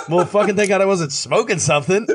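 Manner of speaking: high, laughing exclamation. Real, not acted. Laughing while retelling own reaction.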